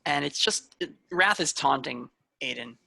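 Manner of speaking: normal talking voice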